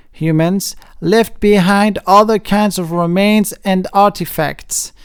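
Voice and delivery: ordinary talk, nothing marked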